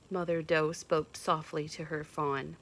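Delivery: ordinary delivery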